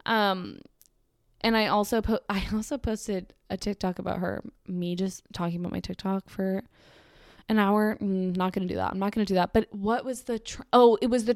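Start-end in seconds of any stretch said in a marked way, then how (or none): none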